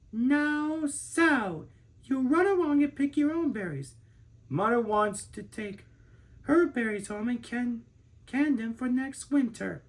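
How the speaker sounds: put-on a patient mother shooing her little girl along; mother's voice. A narrator's reading. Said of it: "Her mother stopped picking and said".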